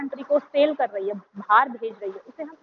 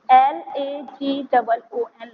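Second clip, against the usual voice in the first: slow letter-by-letter spelling; spelled out slowly; 3 dB louder, much slower than usual. Shown as a demonstration. Teacher spelling lagoon slowly for students. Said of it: "lagoon".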